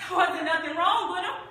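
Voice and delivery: insistent denial; defensive. Acted. Retelling Bo's excuse for himself, insistent.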